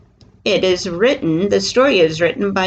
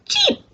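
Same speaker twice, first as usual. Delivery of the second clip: tiny, very high cheep; one short high peep like a chick. A narrator's reading. Storyteller voices the chicks, high short cheep. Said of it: "The chicks say".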